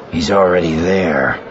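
a touch of unease; understated discomfort; uncomfortable. A narrator's reading. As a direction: report it reluctantly to the boss. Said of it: "Manning said uncomfortably".

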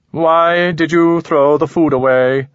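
high, slow, detached alien; cool otherworldly being, measured and precise. Acted. Alien questions Ramon.

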